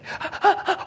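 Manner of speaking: sharp high gasp with a laugh; mock panicked gasp. Older brother mimics his sister's panicked gasp. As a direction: gasp like a kid suddenly scared.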